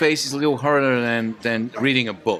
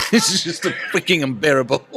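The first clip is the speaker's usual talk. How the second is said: laughing; 3 semitones higher, 4 dB louder than usual. Real, not acted. Mocking the musicals.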